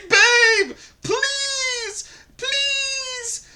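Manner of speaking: very high, drawn-out mock begging; hammed-up high-pitched desperate apology; grovelling plea. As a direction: beg on your knees in a squeaky wail. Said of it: "You're on your knees saying".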